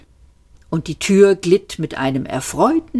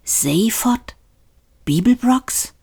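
weak stunned whisper; faint whisper; 6 semitones higher than usual. A narrator's reading. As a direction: barely breathe the name in shock. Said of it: "flüsterte er matt".

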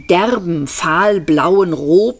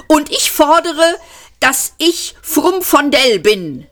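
high, loud demanding yell; shouting; 11 semitones higher, 4 dB louder than usual. A narrator's reading. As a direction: yell the demand at full pitch. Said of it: "schrie der Jüngere". (hoher, lauter fordernder Schrei)

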